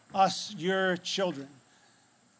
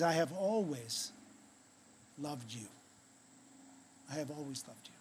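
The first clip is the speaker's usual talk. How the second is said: very soft, low, intimate assurance; tearful tenderness; 5 semitones lower, 12 dB quieter than usual. Retold or imitated. Jesus holds you close, speaking in hushed tenderness. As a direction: let it sink almost to nothing. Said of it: "with tears in his eyes".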